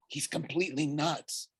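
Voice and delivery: soft, high stage whisper. A narrator's reading. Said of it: "Bianca whispered to me".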